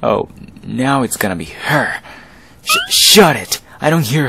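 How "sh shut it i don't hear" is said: much higher, loud cracked shut it; frightened yell. Acted. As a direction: scream it down out of fear. Bully yells, scared of ghost.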